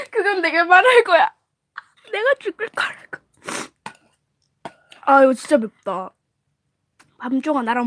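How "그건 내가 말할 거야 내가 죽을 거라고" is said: mock crying. Dramatic reply, crying sound.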